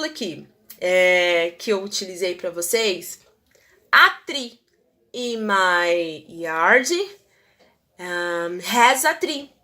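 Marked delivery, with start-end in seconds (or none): 3.9-6.9 "in my yard" stretched out very slowly; teacher dictating the example; slow example reading. Shown as a demonstration. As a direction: read it slowly for learners. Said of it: "teacher reads example".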